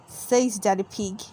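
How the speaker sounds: ordinary delivery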